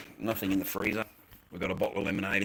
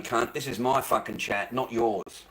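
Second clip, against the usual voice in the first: normal talking voice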